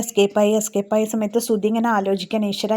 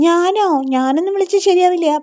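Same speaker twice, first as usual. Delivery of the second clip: surprised refusal; 8 semitones higher than usual. Acted. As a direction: jump up high as if caught off guard. Revathi asked to make call.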